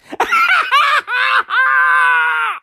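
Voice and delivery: howling high laugh; shrieking laugh. Real, not acted. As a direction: burst out laughing at the absurdity. Laughs at the snakes rumour.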